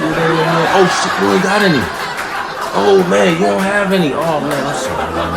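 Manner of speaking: put-on high, loud, laughing kid bragging about his cornrows; gloating son. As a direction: crow over your little brother, loud and high.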